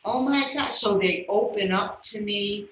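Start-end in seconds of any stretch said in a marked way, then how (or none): 0.1-0.7 surprised gasp. Retold or imitated. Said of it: "They're like".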